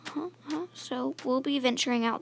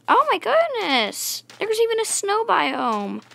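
higher, louder, slow drawn-out wonder; excited discovery; 10 semitones higher, 6 dB louder, slower than usual. Real, not acted. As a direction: gasp with delight at the find. Spots a snow biome.

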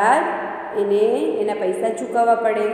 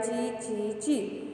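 very soft, slow bird chirp; whisper-soft chirping like a sparrow; dainty chirp imitation; 12 dB quieter, much slower than usual. Chirp repeated in dictation.

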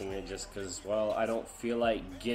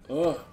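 loud effort grunt; 7 semitones higher, 10 dB louder than usual. Real, not acted. Straining kick.

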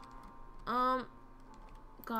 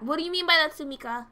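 raised-voice questioning; alarmed demand; 2 semitones higher, 8 dB louder than usual. Real, not acted. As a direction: demand an explanation, louder. Repeats question to game character, alarmed.

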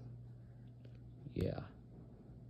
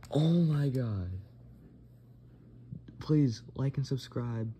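excited; 5 semitones higher, 4 dB louder, slower than usual. Real, not acted. After midnight celebration.

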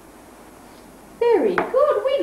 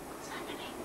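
hushed whispered question; 23 dB quieter, much faster than usual. A narrator's reading. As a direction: lean in and whisper the suspense. Storyteller's suspense question.

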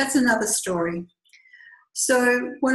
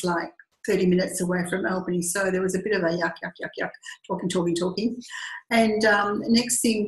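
ordinary talk, nothing marked

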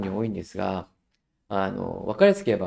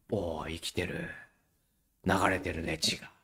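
soft amused murmur; 5 semitones lower, 8 dB quieter than usual. Retold or imitated. Teacher soothing fidgety child.

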